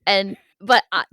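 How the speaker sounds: ordinary talk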